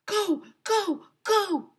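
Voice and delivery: high, loud go go go; urging kids out fast; teacher shouting. A narrator's reading. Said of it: "shouted Missus Meyer".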